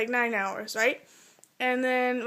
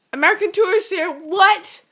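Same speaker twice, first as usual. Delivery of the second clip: baffled mock outburst; 6 semitones higher, 6 dB louder than usual. Acting out her baffled reaction.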